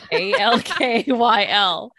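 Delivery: A L K Y L spelled slowly and loudly; spelling out letters. Shown as a demonstration. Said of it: "How is it spelled?".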